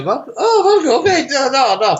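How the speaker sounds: squealing high, laughing no-no-no; mock polite refusal. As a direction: play coy about the vodka, then give in. Offered vodka, mock refusing.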